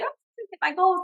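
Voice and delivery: light, high burst of retold glee; excited retelling. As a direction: imitate yourself arriving thrilled, pitch jumping up. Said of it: "I'd come in so excited".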